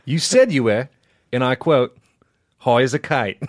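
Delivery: put-on Southern judge quoting the defendant; measured Southern ruling, pausing before the punchline. Judge quoting the accused's own words back, Southern judge voice. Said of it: "Just do Southern Judge".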